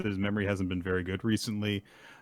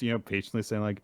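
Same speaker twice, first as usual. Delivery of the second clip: ordinary delivery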